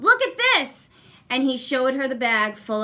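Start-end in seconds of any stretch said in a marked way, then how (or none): 0.0-0.9 high, loud, thrilled; excited boy. Acted. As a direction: show off the gold proudly. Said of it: "Jack called".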